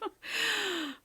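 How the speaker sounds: high gloating laugh; villain laugh. Acted. Proc laughs at the tangled girl, gloating. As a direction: laugh like a pleased schemer. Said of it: "The Proc began to laugh".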